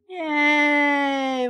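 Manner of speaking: put-on a touch of a cheering kid; mild happy scream. A narrator's reading. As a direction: cheer it, lightly played. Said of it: "Luke screamed".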